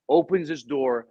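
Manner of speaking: normal talking voice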